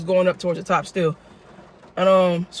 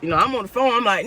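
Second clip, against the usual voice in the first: ordinary talk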